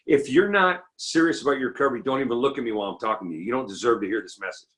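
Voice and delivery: ordinary talk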